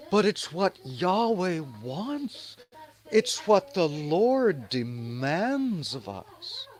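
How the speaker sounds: high, slow, sanctimonious believer voice; mock pious believer. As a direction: drawl it out like a smug zealot.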